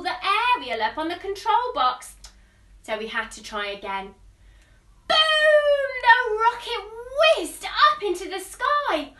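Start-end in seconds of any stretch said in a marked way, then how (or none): 5.1-9.1 high loud boom then thrilled telling; excited blast-off. Acted. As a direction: let it explode with joy. Rocket blasts off.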